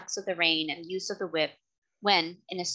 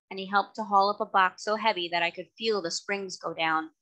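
ordinary delivery, nothing marked

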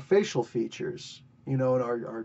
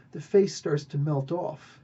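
normal talking voice

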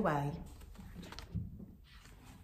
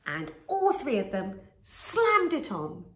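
ordinary delivery

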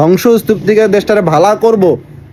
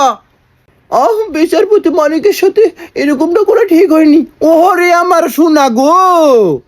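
high, drawn-out mock lament; mock pity; 7 semitones higher, much slower than usual. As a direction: coo sarcastically over him, stretching the ending. Mocking those who pity the judge.

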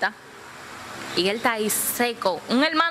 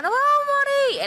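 acted panicked crying; 4 dB louder than usual. Guapón breaking down as the yola takes water, imitated wailing. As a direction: wail in mock terror. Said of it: "empieza a llorar".

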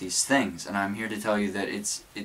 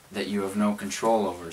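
ordinary talk

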